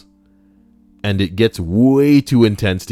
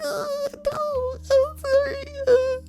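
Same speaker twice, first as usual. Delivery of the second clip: very high whiny apologetic voice; hammed-up high pleading voice; groveling mock sob. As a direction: whimper apologies in a squeaky put-on voice. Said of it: "And she's like".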